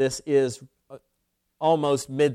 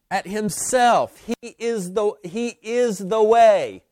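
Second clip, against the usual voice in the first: preaching; 2 semitones higher, 3 dB louder than usual. Real, not acted. Pastor drives home to the congregation, full-force preaching.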